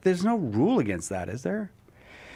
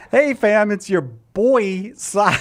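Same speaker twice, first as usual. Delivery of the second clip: loud hyped influencer greeting, stretched; ironic zoomer; 5 semitones higher, 6 dB louder, much slower than usual. Acted. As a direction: open big like a Gen Z streamer. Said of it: "Cy promoing something in Gen Z slang".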